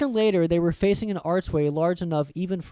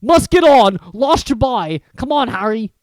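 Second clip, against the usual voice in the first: much louder hurried call; loud Hagrid; 6 semitones higher, 10 dB louder than usual. Acted. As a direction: hurry everyone along. Hagrid hurrying them.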